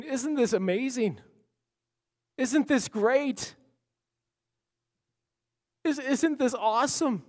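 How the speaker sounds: high, slow, marveling exclamations; awed wonder. Retold or imitated. [name] voicing Brent marveling in the dream, high and awed. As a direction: gush about how wonderful it is.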